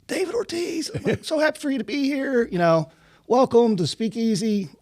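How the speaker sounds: excited greeting. Retold or imitated. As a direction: gush like a starstruck fan. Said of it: "I was excited to see him".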